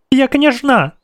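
put-on very high, imperious child voice; acted indignant girlish squeal; squealing princess. A narrator's reading. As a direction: pitch it way up as an outraged little princess. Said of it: "досить цього вереску".

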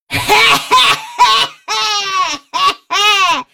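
high, loud hoarse cackle; drawn-out raspy laughter. Acted. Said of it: "Знову почувся хрипкий сміх".

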